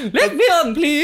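high, hammed-up lady voice; man posing as woman. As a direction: beg in a mock-female falsetto. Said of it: "men were dressing up as women".

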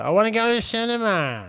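high, loud mock-babyish demand; childish demand. Donald pestering his host for an outing, high and babyish. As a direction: imitate a spoilt guest, pitched right up.

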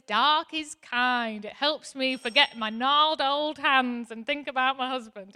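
lightly voiced slow old lady; a gentle, lightly voiced old woman remembering. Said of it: "she says".

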